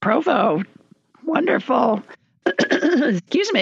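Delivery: hoarse with a frog in the throat; throat catching, clearing it. Real, not acted. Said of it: "I got a frog in my throat".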